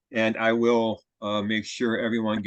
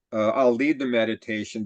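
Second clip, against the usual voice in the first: ordinary delivery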